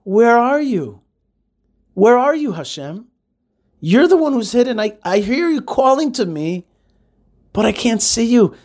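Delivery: high, pleading search for a hidden God; man crying out to God. Acted. Adam calls back to the hidden God, high and pleading. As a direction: call upward, longing and searching. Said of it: "and man said back to him".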